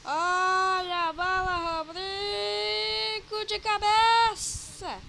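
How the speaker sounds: loud, high, drawn-out call; excited commentary. Real, not acted. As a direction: call the header at full voice. Live commentary, dangerous header. (narração empolgada e gritada)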